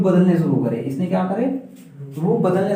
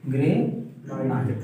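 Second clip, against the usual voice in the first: drawn-out, softer repeat of the answer; slow confirmation; 3 semitones lower, 4 dB quieter, much slower than usual. Shown as a demonstration. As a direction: echo it slowly so it sinks in. Teacher repeats pupil's answer slowly. (धीमे स्वर में खींचकर दोहराना)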